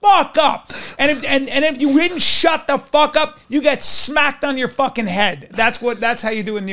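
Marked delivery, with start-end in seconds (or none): 0.0-0.7 New York tough guy. Retold or imitated. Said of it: "we'd be like".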